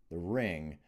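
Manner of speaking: ordinary delivery, nothing marked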